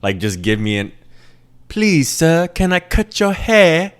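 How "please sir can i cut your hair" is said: high, slow pleading voice; begging barber. Acted. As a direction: beg for a client in a put-on high voice. Acting out a desperate barber begging.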